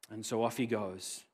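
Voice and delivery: ordinary talk, nothing marked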